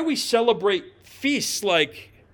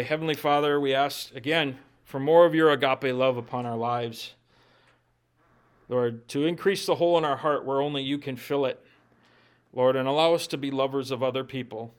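closing prayer; 3 semitones lower than usual. Acted.

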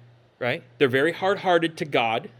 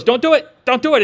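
higher and louder, insistent half-joking warning; wife's mock warning; 8 semitones higher, 8 dB louder, faster than usual. Wife warning him off the late call, in a louder, higher mock plea. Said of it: "my wife goes".

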